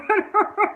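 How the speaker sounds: loud high laugh; real amused laugh after the quote; bursting out laughing; high burst of laughter. Real, not acted. Laughs at own story.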